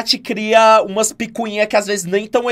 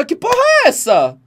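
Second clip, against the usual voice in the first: high-pitched, incredulous retold outburst; baffled outrage; 9 semitones higher than usual. Streamer retelling their reaction to the gossip, incredulous. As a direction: exclaim it in exaggerated disbelief, pitched up.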